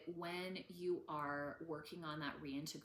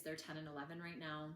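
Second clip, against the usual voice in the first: normal talking voice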